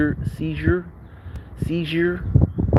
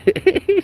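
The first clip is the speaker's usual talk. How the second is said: high wheezing laugh; burst of laughter; 5 dB louder than usual. Real, not acted. Laughing at mom paying the bus in coins, high wheeze. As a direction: cracks up mid-sentence.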